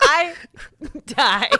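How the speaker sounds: high, loud, helpless laughter in the words; cracking up. Real, not acted. Telling the couch story to listeners, cracking up. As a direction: barely get it out for laughing.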